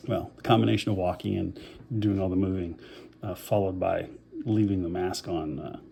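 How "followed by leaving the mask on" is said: ordinary talk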